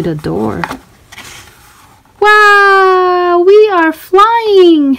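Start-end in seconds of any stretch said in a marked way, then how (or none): 2.2-5.0 high, slow, wonder-filled wow; amazed. Acted. Narrator marvels to the kids as they fly on the broomstick. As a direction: marvel at the flight.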